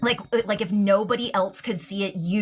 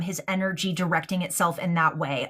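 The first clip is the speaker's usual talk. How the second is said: ordinary talk, nothing marked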